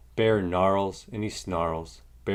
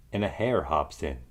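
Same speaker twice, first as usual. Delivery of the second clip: ordinary delivery, nothing marked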